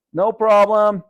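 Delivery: loud high call back; boy shouting through the wall to his father. Acted. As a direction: yell it through the wall to dad. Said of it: "Vinny shouted through the wall".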